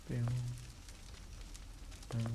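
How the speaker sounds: ordinary delivery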